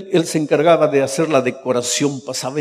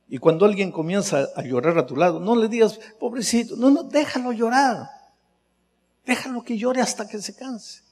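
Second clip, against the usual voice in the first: tender urging; 7 semitones higher than usual. Acted. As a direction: soothe and reassure. Gentle counsel.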